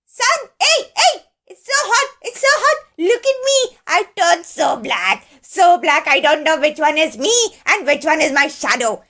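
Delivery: very high, loud squawking fury; angry screaming crow. Acted. Hot crow screaming at the sun in anger. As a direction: screech at the sun to get lost. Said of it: "screamed Kalia Crow in anger".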